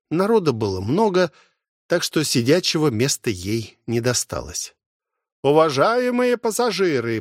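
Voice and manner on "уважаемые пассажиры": high, ringing announcer call; loud announcement. Acted. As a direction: call it out to the whole hall. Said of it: "прозвучал громкий голос глашатая". (громкое объявление глашатая)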